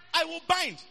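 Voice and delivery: loud, high, with "bind" driven home; emphasis on bind. Shown as a demonstration. Preacher quoting Priscilla's vow to the church, emphatic on "bind". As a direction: raise the voice and punch "bind". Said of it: "and with emphasis on bind".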